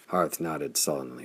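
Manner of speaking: plain reading voice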